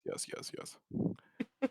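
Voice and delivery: slow breathy whisper. Real, not acted. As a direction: whisper it slowly. Whispered agreement.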